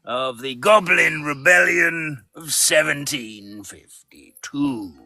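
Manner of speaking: lightly voiced Hogwarts professor; slow, declaiming professor voice; character voice. Performing his Hogwarts character.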